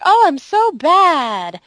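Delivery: high, slow whiny self-pity; mock self-scolding. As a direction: drag it out in mock guilt. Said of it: "everybody's like".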